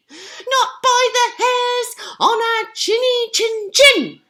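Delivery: high defiant piggy shout. Acted. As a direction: shout back bravely. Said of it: "shouted Percy".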